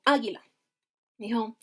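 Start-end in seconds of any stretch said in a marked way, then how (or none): 0.0-0.4 águila stressed on its opening A, falling after; águila with first A punched, ending dropped; stress on first syllable. Shown as a demonstration. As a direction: say águila as AH-gui-la. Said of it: "¿dónde va el acento?".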